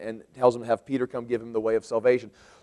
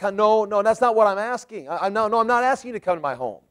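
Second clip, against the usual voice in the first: higher, fast "No, no" correction; contrary protest; 7 semitones higher than usual. Shown as a demonstration. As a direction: hurriedly wave off the offer.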